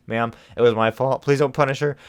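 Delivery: loud, begging cry; desperate plea. Acted. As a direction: plead loudly for your friend. Adora pleading desperately.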